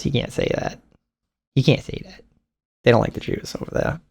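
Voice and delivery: unvoiced whisper; conspiratorial whisper about the audience; hushed aside. Real, not acted. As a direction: whisper it like a secret.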